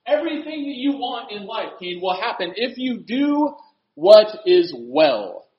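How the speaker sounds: high, ringing, stretched-out promises; emphatic pulpit proclamation; impassioned preaching. Real, not acted. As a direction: build each promise like a revival preacher. Preaches promise at full force.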